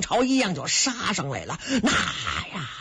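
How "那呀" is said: long-drawn hoarded war cries; storyteller's roar of advancing troops; battle cries. A narrator's reading. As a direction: draw out the shouts of a charging army. Enemy army's battle cries surging in.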